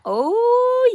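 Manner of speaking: high, bright oh of surprise; a sudden pleased gasp of a word; delighted oh. Real, not acted. Admiring the growing work.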